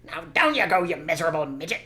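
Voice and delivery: loud high bullying order; bully the little man; loud contemptuous command; sneering order. Acted. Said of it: "said Bean".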